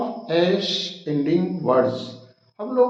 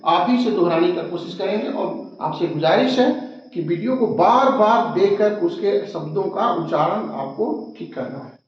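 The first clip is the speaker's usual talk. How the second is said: usual speaking voice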